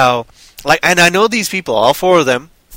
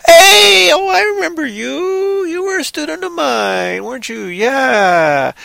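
high-pitched mock greeting that sinks at the end; overacted actress; 11 semitones higher than usual. Blind character recognises a former student, mock overacted greeting. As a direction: gush it in a hammed-up high voice, then let it fall away.

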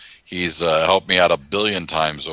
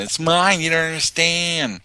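put-on high whiny teen sister voice; mock an outraged teenage sister; high pitched sulky sister; bratty big sister; 8 semitones higher than usual. Older sister bursting into bathroom.

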